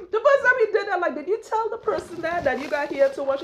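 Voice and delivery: high-pitched, laughing as you talk. Real, not acted. Woman retelling her question about his phone call, laughing. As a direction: squeal it out through laughter. Laughing through a funny memory.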